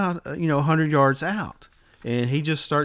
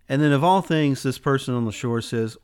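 ordinary talk

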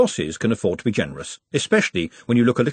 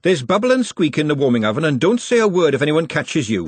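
ordinary delivery

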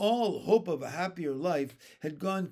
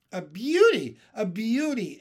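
louder, higher admiring cry; 5 semitones higher, 9 dB louder than usual. Acted. Spiker admiring the peach, excited. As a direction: marvel at the beauty. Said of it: "Aunt Spiker said".

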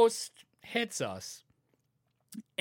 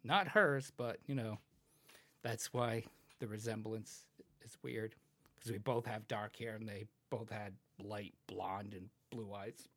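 quiet; 9 semitones lower, 11 dB quieter than usual. Retold or imitated. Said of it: "it's like".

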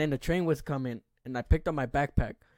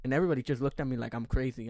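ordinary talk

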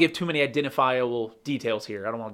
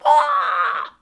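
high squawking bird cry; ostrich impression; 5 dB louder than usual. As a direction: screech like an ostrich. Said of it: "It's my ostrich impression".

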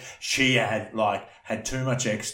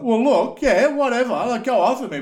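high, loud sassy comeback; mock indignant retort; 11 semitones higher, 6 dB louder than usual. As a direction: imitate her arguing back, pitched up. Acted quarrel, friend talks back.

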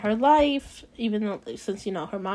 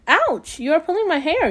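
higher, louder pained yelp at her sister; yelling stepsister; 7 semitones higher, 3 dB louder than usual. Acted. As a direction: yelp it out, stung and indignant. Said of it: "yelled one stepsister".

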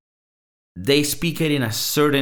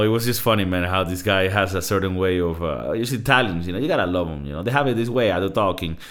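usual speaking voice

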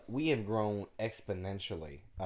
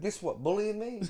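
high, indignant joking comeback; mock indignant retort; 11 semitones higher than usual. Quoting his reply to his girlfriend, mock indignant. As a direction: play offended, pitch climbing.

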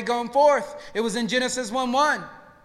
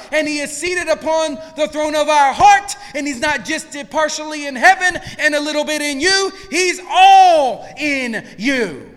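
rising, louder preacher's cry; full-force preaching; 4 semitones higher, 7 dB louder than usual. Acted. Pastor urging the congregation, full-force preaching. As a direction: preach it out to the room.

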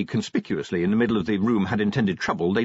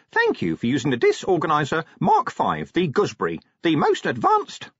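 imp voice; 7 semitones higher, 3 dB louder than usual. Acted. Imp device announcement.

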